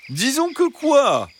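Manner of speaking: high, slow, sweetly pressing fox; insisting fox. Acted. Fox pressing the hens to finish, sweetly insistent. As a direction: press them, still honey-sweet. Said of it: "insista le renard".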